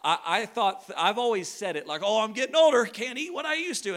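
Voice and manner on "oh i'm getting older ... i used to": high, breezy throwaway phrase; cute little catchphrase. Retold or imitated. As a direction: toss it off high, like a cute saying. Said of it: "say it like a cute little phrase".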